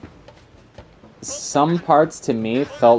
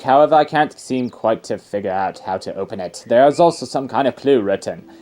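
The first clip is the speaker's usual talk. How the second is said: pompous British archaeologist, louder; posh excited explorer in a British accent; 3 semitones higher, 4 dB louder than usual. Acted. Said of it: "That's a very posh, pretentious".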